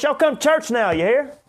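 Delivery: booming mock invitation to church; big loud voice. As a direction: holler it like a jolly country preacher. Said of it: "talk in that big, loud voice".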